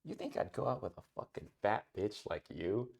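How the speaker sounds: low, quiet cruel sneer; harsh tsundere. Pretend. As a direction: sneer it with contempt. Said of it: "let's switch".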